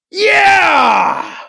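long, high, loud whoop; exuberant whoop. A narrator's reading. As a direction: whoop it out as the car takes off. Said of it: "Thomas lets out a whoop".

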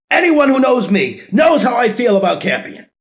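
high, loud bitter statement; seething declaration. Acted. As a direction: lean hard on knows. Candidate raging on TV.

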